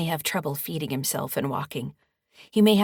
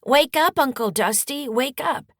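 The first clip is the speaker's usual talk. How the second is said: put-on high, loud little boy calling, rising at the end; a small child begging his uncle to wake up; high-pitched child voice; 9 semitones higher, 8 dB louder than usual. A narrator's reading. Max calling to his unconscious uncle, high child voice. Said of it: "says in a high-pitched voice".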